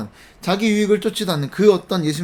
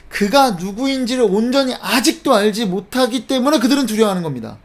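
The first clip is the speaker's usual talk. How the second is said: usual speaking voice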